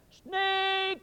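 long, high, loud call into the night; shouting the dog's name in the snow; yelling the dog's name. Retold or imitated. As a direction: call out for a lost dog.

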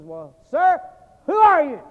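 fed-up friend shouting at the mysterious passenger; frustrated shout. Retold or imitated. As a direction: lose patience and bark the question. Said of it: "my friend got a little frustrated".

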